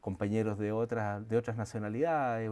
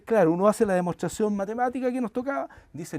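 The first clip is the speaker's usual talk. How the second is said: usual speaking voice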